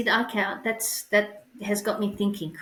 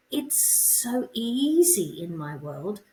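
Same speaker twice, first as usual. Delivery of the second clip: normal talking voice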